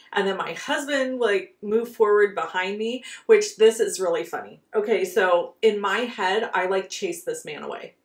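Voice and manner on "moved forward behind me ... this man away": normal talking voice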